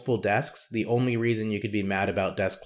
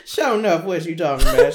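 higher, louder hammed-up Southern accent, laughing; mock Southern twang; 6 semitones higher, 8 dB louder than usual. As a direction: ham up a Southern country voice through laughter. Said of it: "You got a twang".

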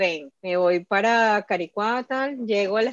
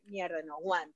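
much softer, muttered under the breath; soft desperate mutter of needing to pee; 5 semitones lower, 11 dB quieter than usual. Retold or imitated. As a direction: say it to yourself, about to burst. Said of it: "y yo dije".

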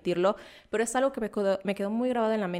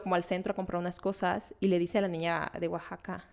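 ordinary talk

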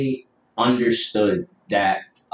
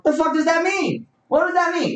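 high-pitched, indignant demand to know; incredulous outrage. Real, not acted. Host tearing into a cheating excuse, incredulous. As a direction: throw it out high in disbelief.

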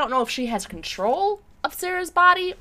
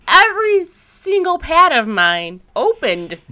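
exasperated; 3 semitones higher, 7 dB louder, slower than usual. Real, not acted. Finding the pads everywhere.

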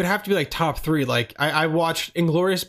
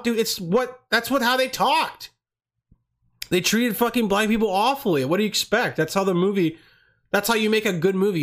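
worked-up rant; 6 semitones higher than usual. Real, not acted. Ranting about Django criticism.

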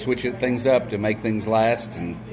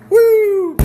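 high, loud mock whoop of a jumper; gleeful whoop; 9 dB louder than usual. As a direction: throw out a whoop as he leaps. Joke: man leaps from window.

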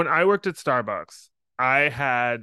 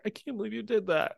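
imitating a high, soft hurt reproach; upset; 7 semitones higher, 5 dB quieter than usual. Boss confronts prankster afterward, upset. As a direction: scold softly in a raised pitch. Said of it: "She was so upset".

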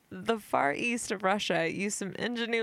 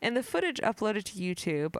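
ordinary talk, nothing marked

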